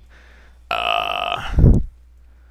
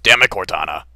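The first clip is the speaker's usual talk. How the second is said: mock scolding; 7 semitones higher, 7 dB louder than usual. Scolding Cortana over porn.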